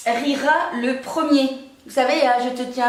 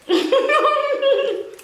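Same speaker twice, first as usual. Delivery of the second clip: giggling fit; 13 semitones higher than usual. Real, not acted. Giggling fit after countdown.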